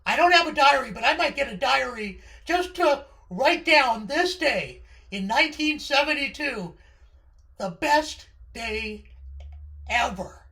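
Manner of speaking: loud and high, bursting with joy; overjoyed kid. Retold or imitated. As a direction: shout it like the happiest day alive. Teller recalls best day.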